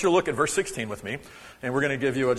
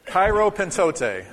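Cairo, pentote said slowly, louder and laughing; louder second try; 4 semitones higher, 3 dB louder, slower than usual. Shown as a demonstration. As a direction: urge the crowd to say it with gusto. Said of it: "We're never gonna learn".

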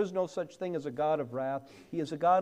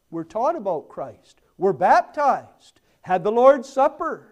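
loud list building higher and louder; emphatic preaching; 5 semitones higher, 12 dB louder than usual. Real, not acted. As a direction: hammer out each item, rising in force.

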